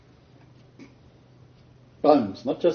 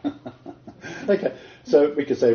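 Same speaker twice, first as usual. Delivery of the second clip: ordinary talk, nothing marked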